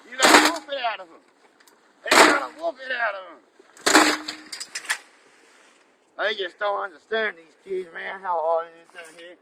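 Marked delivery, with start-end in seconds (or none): 0.1-4.4 frustrated rant. Acted. Frustrated rant about kids.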